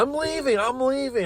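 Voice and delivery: high-pitched mock holler; retold yelling. Worker yelling back while heading out door, retold yelling. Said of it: "I'm yelling there".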